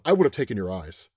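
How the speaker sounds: low parting threat, acted; understated low menace; ominous threat. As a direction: drop your voice for a cold threat.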